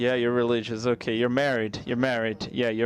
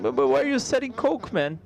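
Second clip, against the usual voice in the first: much higher, alarmed squeak; a nervous traveler, shrill with alarm at the bartender; mock panic; 8 semitones higher than usual. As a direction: panic as if offered drugs. Panicked at the bar.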